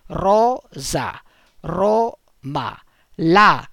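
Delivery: rosa, roma, latinus with gaps between syllables; split into syllables. Shown as a demonstration. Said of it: "Divisione in sillabe".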